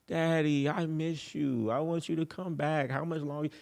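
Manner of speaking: high, drawn-out plea to Daddy; pleading girlfriend. Retold or imitated. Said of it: "you better ask it like that".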